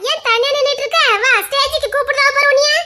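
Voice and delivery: high-pitched, insistent summons; calling over. Acted. Fetching someone standing alone to the stage, calling high. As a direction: call them over to join.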